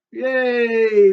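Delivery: cheering. Acted. Brian cheers.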